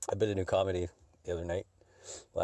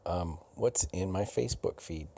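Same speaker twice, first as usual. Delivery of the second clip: usual speaking voice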